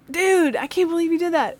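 high-pitched mock outrage of a kid; indignant student. Kyle smacking the buddy who betrayed him, mock indignant. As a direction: imitate a boy protesting to his buddy.

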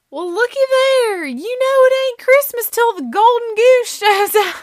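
loud, high Alabama holler. Retold or imitated. Friend announcing the ugly ornament to the room, loud Alabama crowing. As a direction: whoop it in a hammed-up Alabama accent. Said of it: "Lucinda says something like".

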